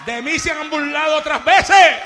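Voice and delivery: high, loud outburst; preaching shout. Real, not acted. Preacher tells the church he has been mocked, shouting. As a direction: cry it out to the church.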